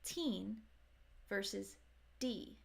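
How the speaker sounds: put-on teen with a crisp T, D as a soft flap; teen versus D. Shown as a demonstration. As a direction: set teen against a quick D. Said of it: "The difference comes at the ending".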